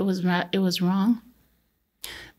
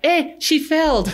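mock yell; 8 semitones higher, 4 dB louder, slower than usual. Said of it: "He'd be the first one to yell".

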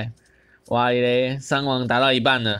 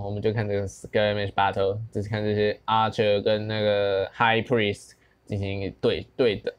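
usual speaking voice

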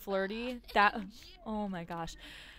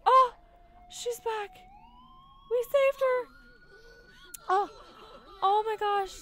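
loud, high cheer of relief; joyful outcry; 13 semitones higher, 8 dB louder than usual. Real, not acted. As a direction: celebrate the rescue. Celebrating her Sim's rescue.

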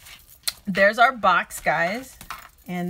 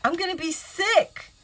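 higher, louder, playing up the drama; dramatic whine; 6 semitones higher, 5 dB louder than usual. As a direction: whine to the husband like a drama queen. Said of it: "I said".

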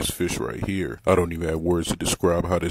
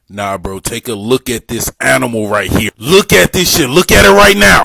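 rises to a loud, high yell; hyped shouting; 6 semitones higher, 16 dB louder than usual. Real, not acted. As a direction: scream at it in disbelief. Hyped yelling at eel.

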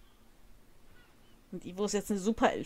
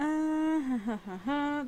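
laughing; 5 semitones higher than usual. Real, not acted. Laughs then answers for game.

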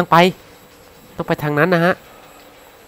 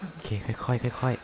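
whispering while hiding; 5 semitones lower, 11 dB quieter than usual. Real, not acted. Hiding from monster, urging quiet.

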